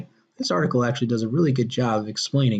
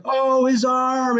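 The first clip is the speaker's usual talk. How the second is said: mock horror; 9 semitones higher, much slower than usual. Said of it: "I was like".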